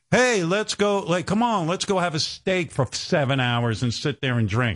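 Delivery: high, hearty mock invitation; car executive. Imitating a car executive inviting him to a long steak dinner. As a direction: play the eager Detroit exec. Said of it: "They'd be like".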